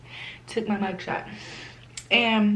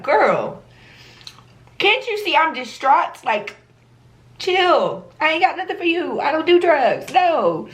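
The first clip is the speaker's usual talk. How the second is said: higher, exasperated, brushing her off; distraught arrestee brushing off an inmate, exasperated; 9 semitones higher than usual. Retold or imitated. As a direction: tell her to back off, fed up. She rebuffs the inmate.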